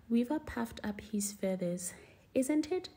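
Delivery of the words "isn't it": a higher, smug little question; proud. A narrator's reading. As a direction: puff up with mild pride. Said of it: "he said proudly".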